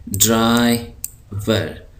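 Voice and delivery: ड्राइवर drawn out very slowly; slow model word. Shown as a demonstration.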